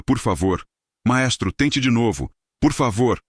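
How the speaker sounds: high, urgent begging; desperate plea. A narrator's reading. Evandro begging host and conductor to retry, high urgent plea. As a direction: plead fast before it collapses.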